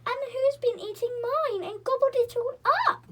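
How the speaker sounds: high, loud tearful little bear voice; weeping baby bear. A narrator's reading. Baby bear wails over his empty bowl, tearful and high. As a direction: wail it like a tiny cub about to cry. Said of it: "wept the baby bear".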